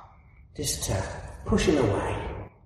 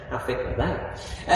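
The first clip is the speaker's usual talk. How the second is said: ordinary delivery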